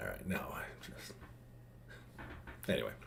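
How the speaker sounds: soft whispered trailing off; hushed fading mumble. Real, not acted. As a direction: drift off under your breath. Trailing off after laugh.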